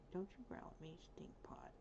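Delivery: whispered scold to the dog; hushed scolding. Real, not acted. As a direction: tell the dog off under your breath. Scolding the growling dog softly.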